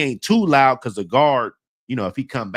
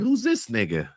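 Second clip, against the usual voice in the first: laughing disbelief; 8 semitones higher, 4 dB quieter than usual. Real, not acted. Mocking the shirtless new guy, laughing.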